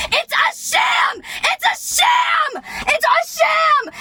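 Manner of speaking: repeated high, outraged shout; shouting in outrage. Real, not acted. Ranting to the camera about the system, outraged shout. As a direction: yell it like a protest chant.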